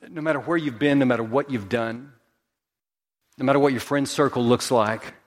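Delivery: ordinary delivery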